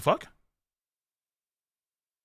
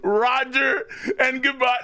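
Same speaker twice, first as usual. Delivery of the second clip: high laughing yell; real laughing hype; laughing shout; 6 semitones higher, slower than usual. Real, not acted.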